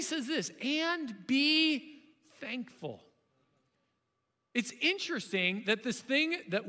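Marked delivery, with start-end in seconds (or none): 0.6-3.0 slow emphatic verse. Real, not acted. Reading key verse slowly.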